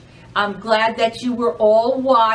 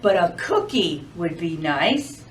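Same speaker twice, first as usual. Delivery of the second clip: lower, softer snowman voice; lightly voiced snowman, calm; 5 semitones lower, 3 dB quieter than usual. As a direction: give the snowman a gentle low voice. Snowman speaks in story.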